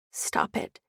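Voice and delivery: whispered stern stop it; a remembered warning, barely breathed; hushed. Retold or imitated. Holly recalls grandma's words, whispered and stern.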